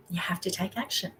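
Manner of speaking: quiet firm repetition; soft insistent repeat. Real, not acted. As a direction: drop it down and let it sink in. Repeats the urge to act.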